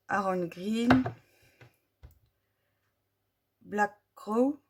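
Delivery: English color names in a heavy French accent; reading English names with a clumsy French accent. Real, not acted. Vlogger reading English pen color names in her French-accented English. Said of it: "excusez-moi pour mon accent anglais qui est nul".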